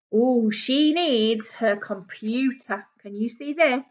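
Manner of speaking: ordinary delivery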